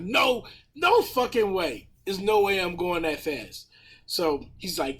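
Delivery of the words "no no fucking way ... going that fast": higher, drawn-out disbelieving protest; indignant denial. Retold or imitated. Driver retelling his protest to the cop, indignant denial. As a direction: push back in disbelief, pitch jumping up. Acted protest at the cop's accusation.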